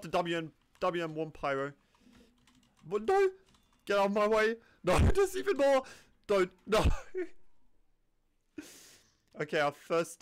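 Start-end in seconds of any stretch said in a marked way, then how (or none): 2.9-6.8 frantic high shouts of no; panicked yelling. Real, not acted. Gamer yelling at enemies swarming him, panicked shout. 6.9-7.5 squeaky unvoiced laugh after panic; breathless high laugh. Real, not acted. Gamer laughing at his own panic, high breathy giggle.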